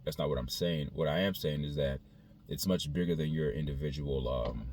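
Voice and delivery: normal talking voice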